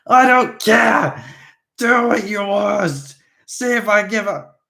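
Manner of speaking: put-on high, loud, slow drunken screaming; raging drunk vampire screams. A narrator's reading. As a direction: howl your defiance at the sun. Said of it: "screaming at the sun".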